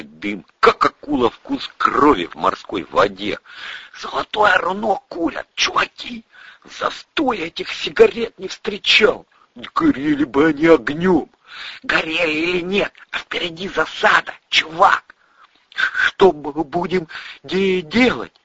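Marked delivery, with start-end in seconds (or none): 4.0-15.1 high-pitched, jittery buddy voice; high character voice, urgent; slangy young sidekick. Acted. 15.7-18.3 slow, high, nervous stutter; worried stammering question; anxious stammer. Acted. Anxious stammerer asks about the ambush.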